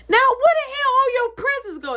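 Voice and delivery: sharp high scolding mother; mom scolding. Retold or imitated. Mom scolding about missing presents.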